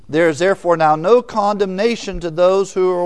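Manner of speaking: preacher booming the verse; emphatic scripture reading. Shown as a demonstration. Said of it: "Look at the New American Standard".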